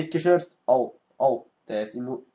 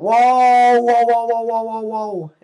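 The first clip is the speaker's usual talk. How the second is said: amazed wows; 8 semitones higher, 8 dB louder, much slower than usual. Real, not acted. Amazed by game move.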